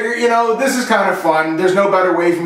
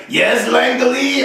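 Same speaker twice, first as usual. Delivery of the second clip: slow, higher French Canadian imitation; mock French Canadian accent; 4 semitones higher, much slower than usual. French Canadian gamer answers his teammate, slow mock accent. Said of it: "he was French, he was from Quebec".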